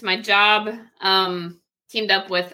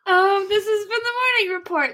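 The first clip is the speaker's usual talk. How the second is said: show sign-off; 12 semitones higher than usual. Acted.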